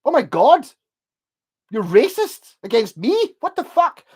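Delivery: high, loud mock outrage, slow in the middle; imitating a jock crying racism, loud and high; outraged jock imitation. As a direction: ham up an offended lad's disbelief. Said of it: "they're just like, pure".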